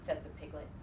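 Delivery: plain reading voice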